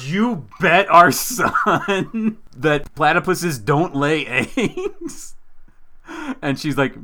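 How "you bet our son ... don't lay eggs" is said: slow, drawn-out incredulous question; amused disbelief. Retold or imitated. Husband learning wife bet their son, slow laughing incredulity. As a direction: stretch each word in laughing disbelief.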